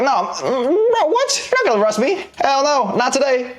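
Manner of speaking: high-pitched, hammed-up disbelief; mock incredulous refusal. Suspect refusing a cop in a tank top, mock incredulous. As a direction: balk in a squeaky, comic protest. Acts out refusing the sloppy officer.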